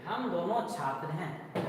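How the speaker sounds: slow, word by word for students to write; teacher dictating a sentence to students, slow and clear; slow dictation. Shown as a demonstration. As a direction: dictate it slowly to the class. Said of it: "चलिए".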